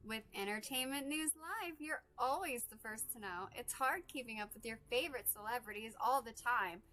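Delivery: customer service voice. Acted. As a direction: sell it like a promo announcer. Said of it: "had my customer service voice on".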